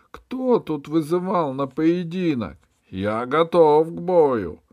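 высокий, громкий, протяжный вызов на бой; бросай вызов через всё поле; крик. A narrator's reading. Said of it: "закричал он". (громкий протяжный крик)

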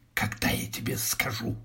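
hushed, secretive whisper. A narrator's reading. Wife instructing her husband at the door, whispering. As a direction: whisper it under your breath. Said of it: "шепнула Монна Тесса".

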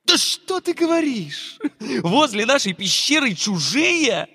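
high, startled question; sudden alarm at strangers nearby; surprised. Acted. Spider teacher surprised at news.

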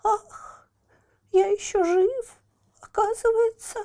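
high moaning sigh of relief; moaning Pierrot. Acted. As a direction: groan it high and thin. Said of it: "простонал". (стонущий Пьеро)

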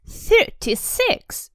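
Dudley counting his presents, whiny and high; spoiled child. Acted. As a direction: count like a sulking spoiled boy. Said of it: "he said".

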